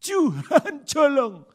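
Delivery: loud, slow, wailing cry for help; desperate cry. Shown as a demonstration. Preacher showing a big-problem prayer, loud slow wail. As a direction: stretch it out and wail to God. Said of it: "masalah besar ngomongnya apa".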